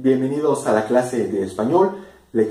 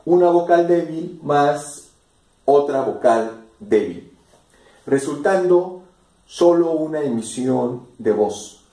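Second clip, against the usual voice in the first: normal talking voice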